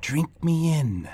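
slow, drawn-out invitation; luring spirit. A narrator's reading. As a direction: coax slowly and eerily. Spirit coaxes the child.

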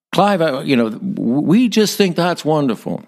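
a self-mocking, hammed-up American accent, pitched high; imitating a church friend in a bad American accent. Clive retelling a church voicemail in a bad American accent. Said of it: "forgive my bad American accent here".

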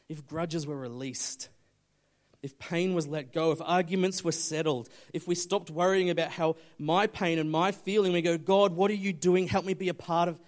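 loud, driving build of appeals; impassioned preaching. Real, not acted. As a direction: press the congregation with a loud plea. Preaches at full force.

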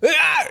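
high startled yelp; startled cry. Retold or imitated. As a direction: yelp like a scared parent. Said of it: "I'm like".

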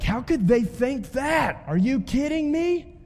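exasperated outburst. Retold or imitated. Pastor acting out an outraged Facebook reader, incredulous shout. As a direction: yell it like a fed-up Facebook reader.

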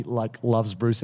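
ordinary talk

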